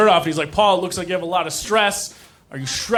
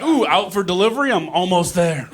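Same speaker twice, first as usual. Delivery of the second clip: ordinary talk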